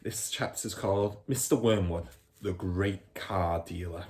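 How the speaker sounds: ordinary delivery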